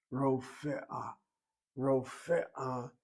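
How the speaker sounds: "Rofe'a" in three clear syllables, ro-fe-a; proper pronunciation. Shown as a demonstration. Teacher modelling the proper word, careful pronunciation. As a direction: say "Rofe'a" with a separate e before the a. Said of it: "is the proper pronunciation".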